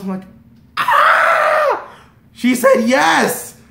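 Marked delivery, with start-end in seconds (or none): 0.8-1.9 very high, loud fangirl scream; ecstatic scream. Retold or imitated. Retold thrill at her saying yes. 2.3-3.6 high, loud, drawn-out exclamation; overjoyed shout. Retold or imitated. Retold thrill at her saying yes.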